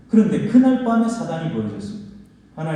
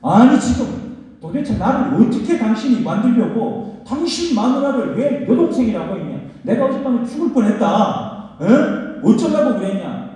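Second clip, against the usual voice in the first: higher, louder scolding shout; a king scolding, voice raised high; king yelling at Abraham, high and loud; angry rebuke; 6 semitones higher, 6 dB louder than usual. Acted. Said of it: "호통을 쳤습니다".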